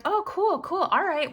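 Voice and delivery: high mock-breezy cool, cool; sarcastic cool. Woman answers the neighbor's excuse, chirpy sarcasm. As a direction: fake cheer before the real point.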